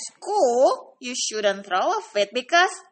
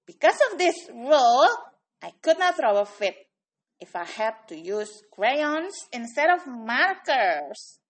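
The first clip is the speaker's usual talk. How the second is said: ordinary delivery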